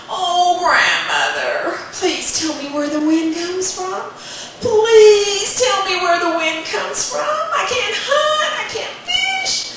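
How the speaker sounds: put-on very high, slow pleading child; begging boy. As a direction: beg harder and harder, climbing up. Boy begs again.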